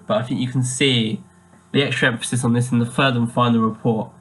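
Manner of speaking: usual speaking voice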